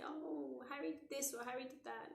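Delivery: high-pitched imitation of a child telling tales; mock a child's wail about a sibling; complaining child.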